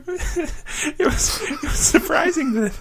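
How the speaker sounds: high-pitched, talking through laughter; laughing. Real, not acted. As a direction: try to get it out while cracking up. Cracking up during improv game.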